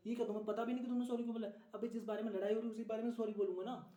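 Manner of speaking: high, fast, indignant back-and-forth; worked-up couple's spat; exasperated quarrel. Acted. Acted quarrel over saying sorry.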